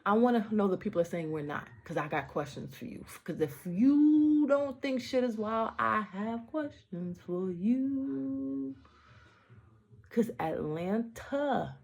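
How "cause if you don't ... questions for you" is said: slow, drawn-out challenge, louder and higher; challenging. Real, not acted. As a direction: stretch every word to call them out. Challenging the doubters, dragged out.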